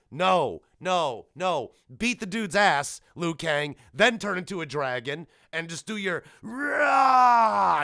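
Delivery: ordinary delivery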